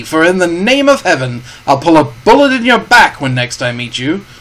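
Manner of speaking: a captain dressing down a pirate; Captain Smollett. Acted. Captain rejects Silver's terms.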